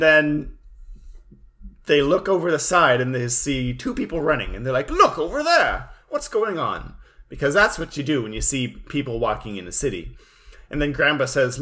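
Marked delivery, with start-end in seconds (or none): 4.9-6.9 high excited shout. Retold or imitated. Friends voiced in recap.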